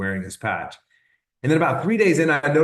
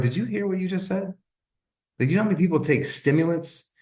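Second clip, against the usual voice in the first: incredulous retort; 8 semitones higher than usual. Retold or imitated. Disbelieving retort to wife in retold exchange.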